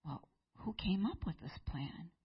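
whispered, almost breathless question; hushed demand. Acted. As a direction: ask it in a shocked whisper. The king demands to know.

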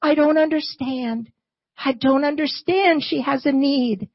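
high anguished cry, shouted then dropping to pleading; crying out to God. Acted. Elijah cries out to God over the widow's son, high and pleading. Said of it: "He cried out".

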